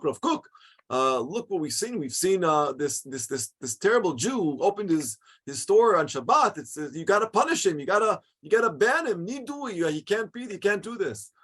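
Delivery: high, heated, indignant outcry; outraged congregants demanding a ban; angry complaint. Retold or imitated. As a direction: rush to the rabbi demanding punishment. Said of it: "they started screaming at him".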